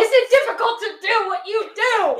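high, loud, exasperated re-asking; loud repeat. Real, not acted. Co-host re-asks the question the guest missed, loudly. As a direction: belt out the whole question for someone not listening.

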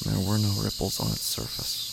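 slow, hushed, half-whispered dread; eerie horror narration. A narrator's reading. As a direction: draw the listener into the dark pond, slowly. Eerie horror story narration opening.

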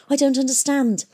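high, bewildered gasp. A narrator's reading. Girl talking to herself as she flees, gasping. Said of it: "gasped Hester, talking to herself".

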